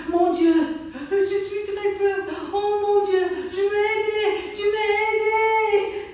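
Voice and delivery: panicked French. Acted. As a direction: shriek it in terror. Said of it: "voices sounded like French".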